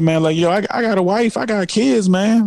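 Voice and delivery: imitating a man protesting, pitched well up; retold protest. Retelling Bosh's protest to critics.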